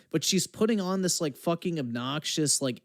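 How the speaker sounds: ordinary delivery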